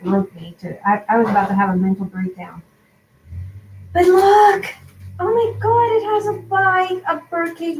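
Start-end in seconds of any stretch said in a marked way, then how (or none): 3.9-7.8 real excitement. Real, not acted. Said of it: "Sorry, I'm so excited".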